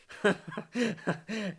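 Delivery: high bubbling chuckle. Real, not acted. Host chuckling after saying see you tomorrow. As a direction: let out a short run of giggles.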